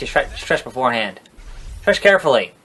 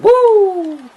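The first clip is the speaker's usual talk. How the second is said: high shouted woo; whooping like Ric Flair; 4 dB louder than usual. Retold or imitated. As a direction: let out a big Flair woo. Ric Flair catchphrase in wrestling game.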